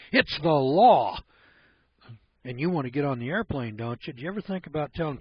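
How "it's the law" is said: mock stern official. Airport guard quoted barking orders.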